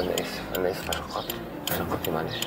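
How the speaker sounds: low, soft apologetic begging; hushed pleading. Acted. Driver begs the passenger for a favour, hushed pleading. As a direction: plead quietly, keeping it low.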